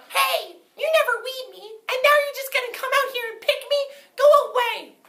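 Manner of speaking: very high, loud squeaky yam voice; tiny high yam yelling at the farmer; angry talking yam. Acted. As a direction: shriek it out like an offended vegetable. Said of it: "did that yam just talk".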